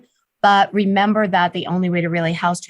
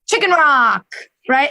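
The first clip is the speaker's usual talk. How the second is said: a bright, higher, louder announcement of the tip; excited; 7 semitones higher, 3 dB louder than usual. Real, not acted. Host proudly announces Kathy's favorite tip, excited. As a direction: sell it like the star tip.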